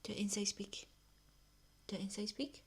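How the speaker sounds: whispering. Real, not acted. Hushing pet for microphone.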